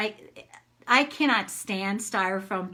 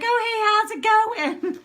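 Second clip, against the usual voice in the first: high, sing-song sudden politeness; way higher, bright and falsely chipper to a neighbor; fake cheerful greeting; 12 semitones higher, 5 dB louder than usual. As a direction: switch from rant to sweet hello. Greeting neighbors mid-rant.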